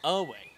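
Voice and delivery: "er way" with ER punched loud; stress on first syllable. Shown as a demonstration. Teacher shows the clumsy stress, loud ER in "er way". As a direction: hit "er" hard, let "way" fall. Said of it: "if you were to stress the".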